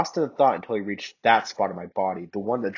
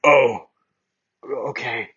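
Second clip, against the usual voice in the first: Bakugo's voice; 4 semitones higher, 5 dB louder, much slower than usual. Acted. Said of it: "that's Bakugo's voice".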